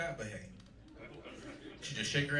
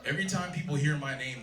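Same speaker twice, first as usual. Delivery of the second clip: ordinary talk, nothing marked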